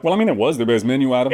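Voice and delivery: unbothered, laid-back brush-off; mock nonchalant. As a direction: shrug it off like nothing matters. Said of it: "are you trying to be nonchalant".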